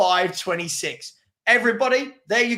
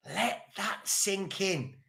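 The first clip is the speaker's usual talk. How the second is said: "Let that sink in." dropped much softer and slow; softer, slower echo; 10 dB quieter, much slower than usual. Shown as a demonstration. To the viewers, a much softer slow repeat. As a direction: let it land in a hush. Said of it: "repeated for emphasis".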